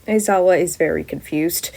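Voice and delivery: usual speaking voice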